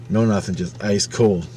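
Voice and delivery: plain reading voice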